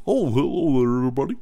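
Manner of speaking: high goofy put-on comedy voice; Adam Sandler voice. Host mocking Sandler's movie voices, goofy high impression. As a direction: mimic a silly Sandler character greeting. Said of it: "why I did that voice".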